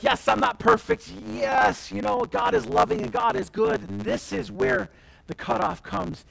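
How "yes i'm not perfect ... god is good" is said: high, loud, singsong voice of a smug excuse; hammed-up high mocking voice; mock excuse-maker. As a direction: parody a self-assured believer brushing off sin, pitched up. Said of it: "this could be like".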